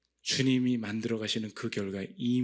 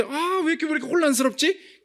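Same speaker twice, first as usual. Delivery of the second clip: much higher and louder flustered cry; exasperated confusion; 8 dB louder than usual. Retold or imitated. Praying woman baffled by the thought, high flustered cry. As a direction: throw up your hands, baffled. Acting out her confused self.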